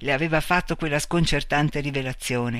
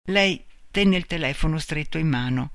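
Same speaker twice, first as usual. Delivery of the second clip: usual speaking voice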